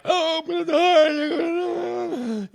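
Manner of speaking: high, hammed-up childish terror; mock panic. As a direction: wail it like a kid who thinks he's dying. Brother smothering him, child panics.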